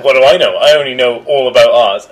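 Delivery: hammed-up pretentious artist; sarcastic boast. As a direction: brag while pretending to be humble. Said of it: "she's like".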